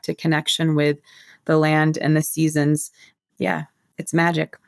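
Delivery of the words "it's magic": ordinary delivery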